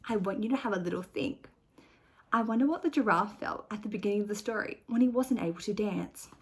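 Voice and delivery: plain reading voice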